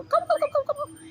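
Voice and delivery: very high squeaky bird voice; imitating a gobbling turkey; turkey impression. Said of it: "it would peck it and go".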